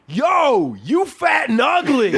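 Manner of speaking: high shout through a laugh; retold shouted insult. Line cook retelling a passing driver's insult, laughing shout. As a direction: holler it out the truck, laughing. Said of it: "leans out of the truck and looks at me and goes".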